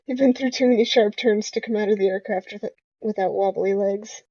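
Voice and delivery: ordinary talk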